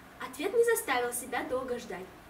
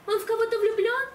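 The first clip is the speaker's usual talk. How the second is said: casual question; 7 semitones higher, 6 dB louder than usual. Acted. Girl asks casually about crush.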